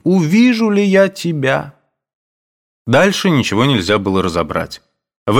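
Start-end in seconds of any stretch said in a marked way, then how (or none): 0.0-1.8 high, tearful question; sobbing. A narrator's reading. Mother weeping on her son's neck, tearful. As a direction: ask through sobs. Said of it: "слышалось среди рыданий".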